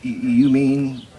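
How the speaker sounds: loud, high, lively recital of a poem's quarrel; hammed-up cowboy poetry performance. Recites poem with dialogue voices.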